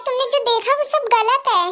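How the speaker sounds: ordinary delivery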